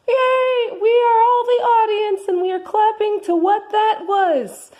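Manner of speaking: high Martha voice. Acted. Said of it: "I am Martha".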